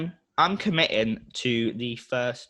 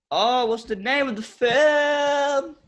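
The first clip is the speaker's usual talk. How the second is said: high drawn-out straining voice; high strained; 6 dB louder than usual. Real, not acted. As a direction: reach for the word, voice climbing high. Straining to remember a title.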